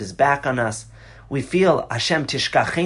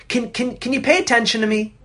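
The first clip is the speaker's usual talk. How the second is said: higher, stammering bid for attention; exasperated plea; 7 semitones higher than usual. Retold or imitated. Speaker mimics someone ignored in an argument, higher exasperated plea. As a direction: stutter out a frustrated request to be noticed. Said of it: "you'll stop and you'll say".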